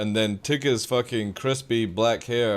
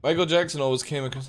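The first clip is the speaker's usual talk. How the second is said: ordinary talk, nothing marked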